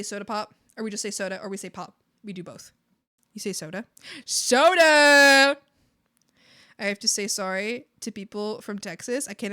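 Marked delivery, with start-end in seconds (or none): 4.2-5.6 long, loud, higher-pitched holler; playful shout. Pretend. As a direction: belt it out, stretching the word. Calls out the soda answer.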